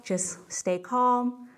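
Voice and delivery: ordinary talk, nothing marked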